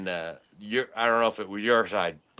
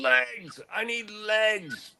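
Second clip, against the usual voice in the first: mock yell, higher and slower; retold screaming; 12 semitones higher, much slower than usual. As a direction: stretch it out high like yelling across the field. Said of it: "I just remember screaming".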